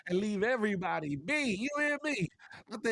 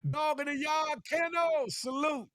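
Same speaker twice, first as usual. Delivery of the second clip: shout-out; 7 semitones higher than usual. Real, not acted. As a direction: call out the names up high. Shouting out to friends.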